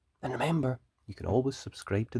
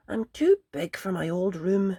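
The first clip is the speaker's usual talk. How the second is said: put-on higher, mildly glum child voice; sad little bunny; 10 semitones higher, 5 dB louder than usual. Peter explaining his trouble to his mother, mildly sad. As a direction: say it a touch sadly like a small bunny. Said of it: "he explained to Missus Rabbit sadly".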